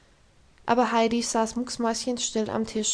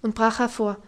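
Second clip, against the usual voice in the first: usual speaking voice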